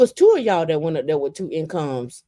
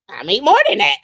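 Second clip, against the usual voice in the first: louder, cocky comeback; smug retort; 5 semitones higher, 6 dB louder, faster than usual. Retold or imitated. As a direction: top her claim with a grin. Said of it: "And I was like".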